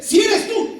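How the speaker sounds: high, loud shout; calling out. Acted. Peter calls to the figure on the water, calling out. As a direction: call it out urgently over the waves.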